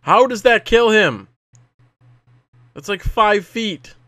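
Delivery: shocked protest, slowing on the second line; outraged disbelief. Real, not acted. Character dies from short fall.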